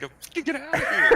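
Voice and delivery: high, loud angry yell breaking into laughter; angry neighbor. Acted. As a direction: yell at them like a grumpy neighbor. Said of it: "the angry guy from across the street".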